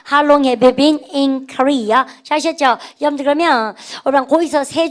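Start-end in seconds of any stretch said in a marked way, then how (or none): 0.1-2.0 slow classroom repeat-after-me; very slow drill reading. Shown as a demonstration. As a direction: say it slowly so students can follow. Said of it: "시작".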